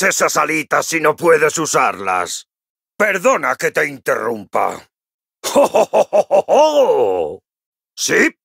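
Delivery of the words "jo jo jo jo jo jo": hearty laugh. Acted. Dwarf delighted in battle, hearty rolling laugh. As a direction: let out a big jolly dwarf laugh.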